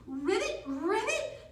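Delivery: put-on high playful ribbit for the kids; frog croak. Teacher leading kids in frog pose, high playful ribbits. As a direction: croak like a happy little frog. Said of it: "Can everybody ribbit with me?".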